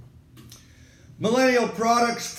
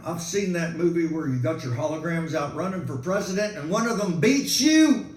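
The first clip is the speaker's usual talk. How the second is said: usual speaking voice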